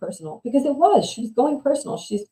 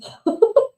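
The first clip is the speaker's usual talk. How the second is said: sharp high burst of laughter; a startled squeal of a laugh; incredulous laugh; 6 dB louder than usual. Real, not acted. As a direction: laugh out at the price in disbelief. Reacts to the wedding cost.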